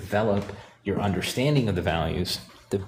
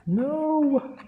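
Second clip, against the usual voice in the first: a sudden, much higher cry of No!; dismayed cry. Real, not acted. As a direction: yelp No! as the paint is spoiled.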